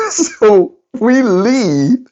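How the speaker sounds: laughing. Real, not acted. Laughing through the line.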